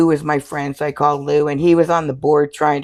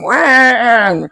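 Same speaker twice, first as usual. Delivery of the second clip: mock wah noise; 7 semitones higher, 7 dB louder than usual. Imitates tattooist's noise.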